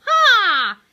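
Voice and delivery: loud, high "Ha!"; mocking jeer. A narrator's reading. Hector scoffs at the hamsters, loud jeer. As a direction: jeer it out loudly. Villain Hector jeers in the story.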